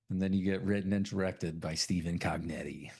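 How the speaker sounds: usual speaking voice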